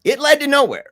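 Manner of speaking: high laughing line. Real, not acted. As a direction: say it through laughter. Laughing while telling.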